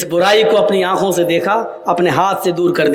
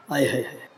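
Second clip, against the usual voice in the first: dismayed wail; 8 semitones lower, 11 dB quieter, much faster than usual. Retold or imitated. Man's dismay at the knife.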